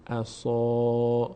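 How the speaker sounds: عصا with a long, stretched final aa; drawn-out recitation. Shown as a demonstration. Tutor showing students how to stretch عصا.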